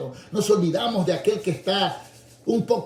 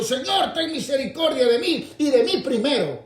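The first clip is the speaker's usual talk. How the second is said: high, louder plea for himself first; selfish plea; 7 semitones higher, 4 dB louder than usual. Retold or imitated. Preacher mocking a selfish blind man's high plea. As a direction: beg Señor loudly, stretching mí. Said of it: "hubiera dicho".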